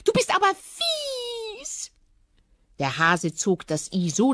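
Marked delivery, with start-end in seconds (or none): 0.0-1.9 drawn-out high-pitched whine; whiny hare. Acted. Hare complaining to the fox that he is mean, high whine. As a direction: wail it high and long.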